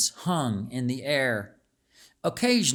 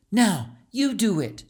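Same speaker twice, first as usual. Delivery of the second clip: put-on higher, encouraging young girl; coaxing; 7 semitones higher, 3 dB louder than usual. A narrator's reading. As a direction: coax your friend to try. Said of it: "coaxed Calixta".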